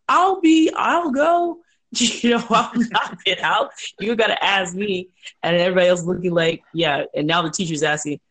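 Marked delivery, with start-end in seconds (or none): 0.1-5.1 high, loud, excited classroom outburst; re-enacted keen pupil bursting to go; eager volunteer. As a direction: jump in first, laughing, voice shooting up.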